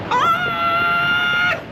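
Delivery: very high, long held scream of joy; shrill long squeal of delight. Real, not acted. Traveler seeing the mountains from her balcony, squealing.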